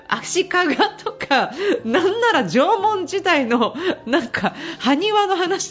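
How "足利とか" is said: slow, laughing aside. Real, not acted. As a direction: stretch it out while laughing. (笑いながらゆっくり)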